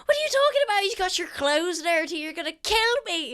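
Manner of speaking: high, loud whiny protest; high whiny complaint. Acted. Senthet whines at his captor in a high voice. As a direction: squeal at him in disbelief.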